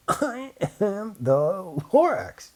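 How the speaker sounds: slow, high put-on Lorax voice; coughing Lorax. A narrator's reading. Lorax returning to complain, slow and high. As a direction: drag it out like a creature with smog in its throat. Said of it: "he coughed and he whiffed".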